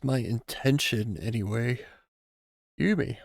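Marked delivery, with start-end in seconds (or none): 2.8-3.2 talking through a yawn. Real, not acted. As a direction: say it mid-yawn.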